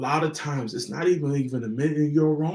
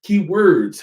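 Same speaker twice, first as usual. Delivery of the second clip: ordinary delivery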